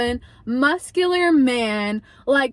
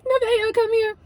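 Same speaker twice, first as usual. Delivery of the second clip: high, tense retold call; urgent summons; 9 semitones higher than usual. Storyteller summons Nevaeh through the window, mock urgent. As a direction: call a friend over, pitched way up.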